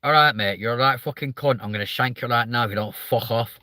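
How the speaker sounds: friendly-sounding northern English threat; northern English accent. Retold or imitated. Streamer mimicking northerners on a night out, matey northern accent. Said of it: "northerners will turn around and go".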